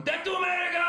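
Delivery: mock fanatic shout. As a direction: yell it like a flag-burning zealot. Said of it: "the crazy dude burning the American flag going".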